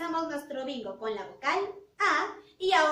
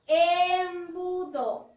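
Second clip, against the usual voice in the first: Embudo drawn out very slowly; slow repeat of Embudo; slow model word; 4 dB louder, much slower than usual. Shown as a demonstration. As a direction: repeat Embudo slowly so the child can check the E. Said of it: "¿Inicia o no inicia con la vocal E?".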